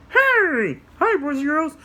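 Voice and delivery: high squeaky puppet greeting; excited high puppet hello; Rusty puppet voice. Acted. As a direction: pop up as a cheerful puppet. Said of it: "like Rusty".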